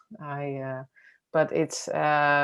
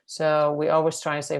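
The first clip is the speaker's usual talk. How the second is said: normal talking voice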